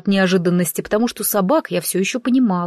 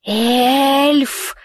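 a creature hissing "Эльф!" sharply; hissed; 5 semitones higher than usual. A narrator's reading. Filthy creature hisses at the fallen woman, hissing. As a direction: spit the word "Эльф!" out like a hiss. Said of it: "прошипело существо".

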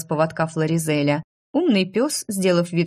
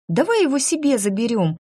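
put-on higher-pitched eager little girl; impulsive, high childish plea; girl exclaiming; 6 semitones higher than usual. A narrator's reading. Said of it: "воскликнула девочка".

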